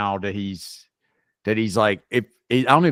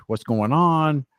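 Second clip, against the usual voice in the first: normal talking voice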